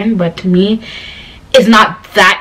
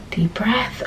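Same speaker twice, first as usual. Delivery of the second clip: much softer self-coaching under the breath; calming herself; 12 dB quieter than usual. Retold or imitated. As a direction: talk yourself down quietly from cussing. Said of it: "I can't be cussing now".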